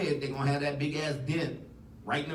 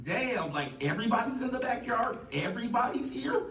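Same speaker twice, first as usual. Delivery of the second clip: climbing, high-pitched disbelief; mock surprise; 9 semitones higher than usual. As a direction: act out walking in on an unexpected crowd. Acting out surprise at crowded house.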